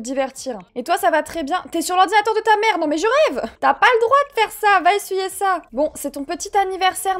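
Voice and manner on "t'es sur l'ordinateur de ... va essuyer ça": high, indignant telling-off; mock parental scolding. Player to child on mother's computer, mock indignant. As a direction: scold loudly like an exasperated parent.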